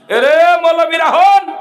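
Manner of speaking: high, loud, slow holler; calling out. Real, not acted. Preacher hollering out to his gathering, loud drawn-out call. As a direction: stretch it out and shout over the crowd.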